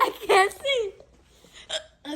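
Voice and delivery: drawn out laughing whine. Real, not acted. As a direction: stretch it out laughing. Laughing struggle with camera.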